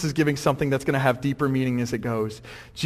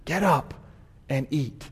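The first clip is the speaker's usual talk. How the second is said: a softened, lowered voice; voice let down; 2 semitones lower, 7 dB quieter, slower than usual. Acted. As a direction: drop your voice and coax him. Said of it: "lets his voice down and says".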